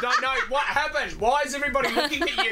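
put-on high, fast kid voice through laughter; a small boy pestering his dad, half laughing; little son again. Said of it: "And Lenny goes".

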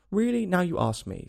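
high, mildly flustered reply; confused. A narrator's reading. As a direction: answer as if thrown by the question. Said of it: "very much confused".

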